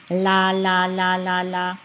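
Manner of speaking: "la" repeated evenly, tongue on the palate; L sound drill. Shown as a demonstration. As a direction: chant "la" with a clear l each time. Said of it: "the L sound".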